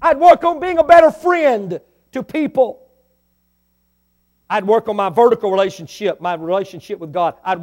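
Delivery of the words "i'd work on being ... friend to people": preaching shout. Real, not acted. I'd work on being a better friend.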